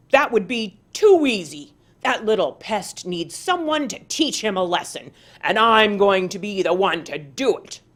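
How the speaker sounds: loud, gloating threat; menacing vow. Acted. Tiger gloating to himself about teaching Armadillo. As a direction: savour the revenge out loud.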